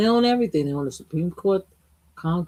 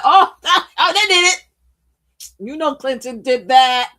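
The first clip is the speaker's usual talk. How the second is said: high, loud, laughing "they did it" jab; mock gleeful accusation; 8 semitones higher, 8 dB louder than usual. Mocking the Republicans' eager blame, high and laughing. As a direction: crow it like you caught them red-handed.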